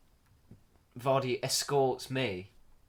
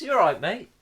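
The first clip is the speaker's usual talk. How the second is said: friendly greeting; 10 semitones higher, 10 dB louder than usual. Retold or imitated.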